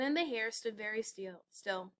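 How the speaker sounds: plain reading voice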